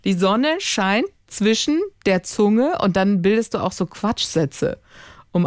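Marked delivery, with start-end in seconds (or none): 0.0-2.8 put-on slow, drawn-out model sentence for a child; speech exercise read out slowly; practice sentence. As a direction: spell it out slowly, word by word.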